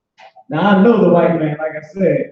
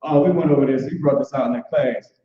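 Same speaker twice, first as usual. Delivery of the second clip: ordinary talk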